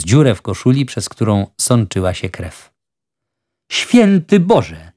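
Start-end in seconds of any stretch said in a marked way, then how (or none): 3.7-4.9 high, drawn-out cry of horror, narrated; an aghast judge, voiced by a storyteller; horrified exclamation. A narrator's reading. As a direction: exclaim slowly in shock, pitch raised. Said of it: "Wykrzyknął przerażony sędzia".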